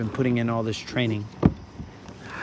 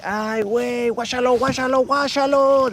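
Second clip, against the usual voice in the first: Mexican announcer; 11 semitones higher than usual. Pretend. Mexican race announcers calling out the skier, high and building. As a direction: hype it up like a Spanish-language commentator calling a race. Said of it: "That's how the Mexican announcers would be".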